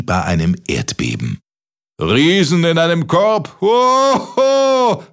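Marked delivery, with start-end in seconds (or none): 2.0-5.0 high, booming mock guffaw; bellowing laughter. A narrator's reading. Giant bawls at the sky over the basket, roaring laughter. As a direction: roar it out with a big "hoho". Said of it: "gröhlte der Riese in den Himmel".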